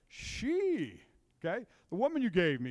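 a hint of Adam pointing the finger, high start; lightly voiced Adam, shifting blame; mock whiny Adam. Adam blaming Eve before God.

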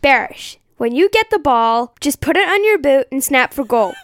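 lightly put-on American accent; attempted American accent. Co-host has a go at the coach's report, mild American accent. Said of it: "I think her accent will be better".

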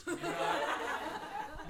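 high, slow, breathless laughing plea. Retold or imitated. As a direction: plead through a giggle. Friends pleading, laughing.

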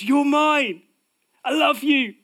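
high, loud cry of love; Father shouting. Acted. The Father shouting love over his children, loud and high. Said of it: "the Father is shouting over us".

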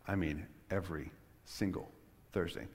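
soft, word-by-word weight on "every single Thursday"; emphatic pauses. Acted. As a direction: set "every", "single" and "Thursday" apart, quietly insistent. Answers the imagined question emphatically.

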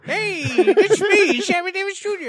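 high, slow, laughing miner voice; old-timey miner voice. Retold or imitated. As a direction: ham up an old prospector. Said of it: "like an old-timey miner".

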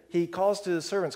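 normal talking voice

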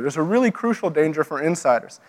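ordinary talk, nothing marked

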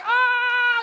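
loud, high held yell; comic scream of ride panic; mock terrified scream. Preacher reliving the ride, screaming in mock terror.

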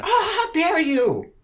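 very high, slow, loud hammed-up gasp of offence; mock the scold's indignation; mock outrage. Mocking the virtue-signaling woman, very high and slow.

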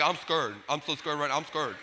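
quiet, small, half-laughing admission of fear; mock scared. Gideon admitting his fear to God, small and quiet. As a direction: shrink down and confess it meekly. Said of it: "Gideon's like".

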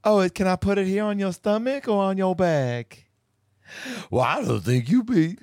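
high, put-on mocking voice of Eric; mock him in a high silly voice; Eric impression. Mocking Eric's voice to her partner, high and silly. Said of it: "that's how he sounds".

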